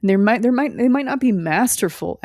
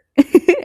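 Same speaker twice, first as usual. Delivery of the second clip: loud laugh; 12 semitones higher, 9 dB louder than usual. Real, not acted. Laughs at herself.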